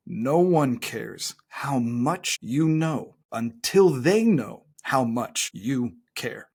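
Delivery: deliberately slow, with long gaps between phrases. Real, not acted. Coach telling coaches that clients need to feel cared for, very slowly. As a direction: spell it out very slowly for emphasis. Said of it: "Say this very slowly".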